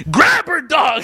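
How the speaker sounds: high, loud egging-on yell; excited mock holler; mock urging shout. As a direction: call it out high like a rowdy buddy. Acting out egging someone on.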